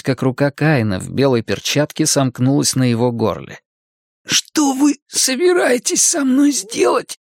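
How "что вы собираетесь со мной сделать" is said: put-on high, slow hoarse boy's croak. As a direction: rasp it out while being choked. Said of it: "прохрипел Макс".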